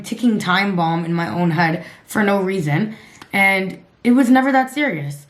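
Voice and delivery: ordinary talk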